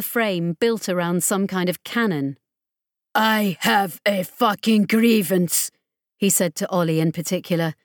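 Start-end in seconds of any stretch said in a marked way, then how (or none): 3.1-5.7 menacing villain. Acted. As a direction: lean on every word with menace. Menacing boss enforcer threatens.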